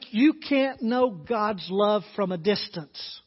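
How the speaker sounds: loud, rising sermon delivery, pressing each phrase; preacher urging his congregation toward God's love, preached at full force; fervent preaching. Acted. Preach it hard to the pews.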